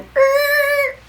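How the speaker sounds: high, loud screeching noise; imitated screech. Shown as a demonstration. Vlogger joking about plans derailed by a new fancy, high screeching noise.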